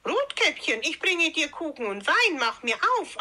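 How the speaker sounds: put-on wolf posing as a girl, pitched high; disguised voice. A narrator's reading. Wolf at Grandmother's door posing as Rotkäppchen, disguised voice. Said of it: "antwortete der Wolf mit verstellter Stimme".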